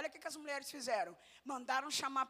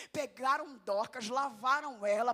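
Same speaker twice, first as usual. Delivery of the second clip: normal talking voice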